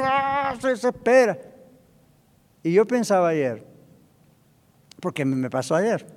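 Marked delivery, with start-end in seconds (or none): 0.0-1.4 high, strained cry of impatience; exasperated driver. Acted. Driver stuck behind an old lady crossing, exasperated. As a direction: act out a driver losing patience. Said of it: "está desesperado el tipo allí".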